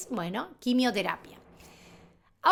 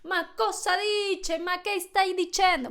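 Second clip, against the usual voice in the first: higher sing-out Italian intonation; lively Italian street talk, pitched up; Italian accent; 5 semitones higher than usual. Acted. Teacher showing how her brain speaks Italian, high Italian accent. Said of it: "hablar así es así".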